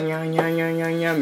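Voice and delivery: fast mock babble imitating unclear speech; mocking an Indian colleague's unclear talk, fast babble; mock gibberish. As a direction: rattle off nonsense syllables quickly. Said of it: "みたいな言うて".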